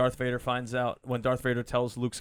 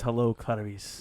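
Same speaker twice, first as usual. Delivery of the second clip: creepy; 4 semitones lower, 5 dB quieter, slower than usual. Retold or imitated. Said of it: "He never says".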